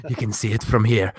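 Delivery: a feeble stab at an Irish accent; lightly put-on Irish lilt, low; botched Irish accent, half-hearted; bad Irish accent. Shown as a demonstration. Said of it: "I can't do an Irish accent".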